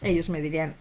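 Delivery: ordinary delivery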